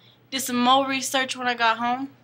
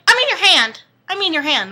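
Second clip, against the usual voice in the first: high, loud backtracking; mock flustered correction; 7 semitones higher, 7 dB louder than usual. Girl corrects her slip to the vampire, loud flustered voice. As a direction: blurt the correction in a panic.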